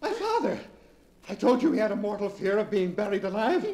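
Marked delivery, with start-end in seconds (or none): 0.0-0.6 higher, bursting with the idea; an idea dawns; excited realization. Acted. Leonard remembers his father's telephone, excited.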